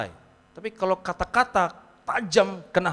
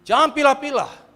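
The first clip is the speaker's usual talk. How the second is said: shouted command; 9 semitones higher, 12 dB louder than usual. Real, not acted. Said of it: "shouted command".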